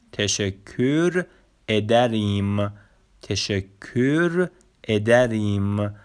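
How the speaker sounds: تشکر ادریم said slowly, piece by piece, as teşekkür ederim; slow Turkish demo. Shown as a demonstration. Teacher models teşekkür ederim slowly for learners. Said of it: "اصطلاحاتمون رو به ترتیب بخونیم".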